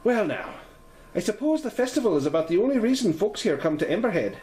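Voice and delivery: a homely Irish host explaining the festival; Irish lilt. Acted. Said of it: "Her voice has a slight Irish lilt".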